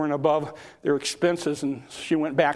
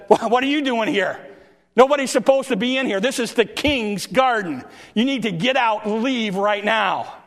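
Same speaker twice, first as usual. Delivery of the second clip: higher, louder scolding guard; indignant gardener; 5 semitones higher, 3 dB louder than usual. Acted. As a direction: chase the intruder out of the king's garden. Indignant gardener ordering her out.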